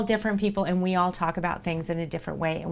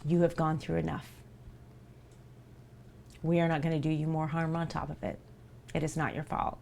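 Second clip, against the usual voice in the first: soft reassurance; 4 semitones lower, 10 dB quieter than usual. Real, not acted. Reassuring a hurt community member.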